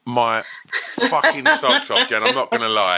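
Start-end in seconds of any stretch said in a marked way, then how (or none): 1.1-3.0 laughing. Real, not acted. Laughing while telling of meeting hero.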